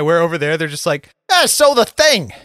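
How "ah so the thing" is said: high, loud, plummy British put-on; mock proper British accent. Joking about how Brits describe UFOs, high mock British accent. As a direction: ham up a posh British gent. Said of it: "the British accent as being, like, so proper".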